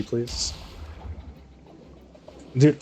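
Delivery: ordinary talk, nothing marked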